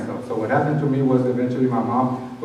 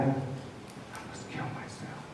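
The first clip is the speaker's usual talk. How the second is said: barely voiced, slow inner thought; whispered thought; 19 dB quieter, much slower than usual. Man voicing his suicidal thought, slow near-whisper. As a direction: whisper it to yourself, slow and hollow.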